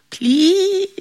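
very high, thin begging; desperate plea. Acted. Devil begs John to free him, desperate. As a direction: beg in a tiny high voice.

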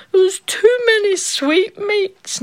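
high, slow tearful whine; whining child queen. A narrator's reading. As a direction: whimper like a spoilt child.

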